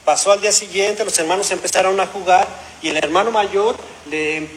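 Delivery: ordinary talk, nothing marked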